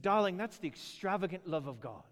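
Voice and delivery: light imitated British accent. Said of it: "everything's better when you say it British".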